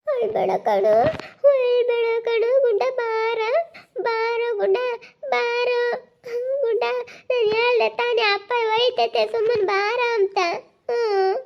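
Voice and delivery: put-on high, tearful child voice; crying plea. Child calls Gunda back from father, crying and pleading. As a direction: plead through sobs, drawing the words out high. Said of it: "ಅಳ್ಬಾರ್ದು, ಸುಮ್ಕಿರಮ್ಮ".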